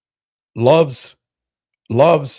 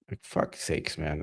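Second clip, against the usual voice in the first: muttered curse; 8 semitones lower, 14 dB quieter than usual. Real, not acted. Exasperated aside.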